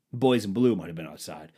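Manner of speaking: ordinary talk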